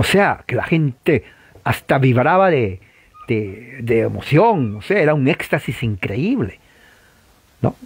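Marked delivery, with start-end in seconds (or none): none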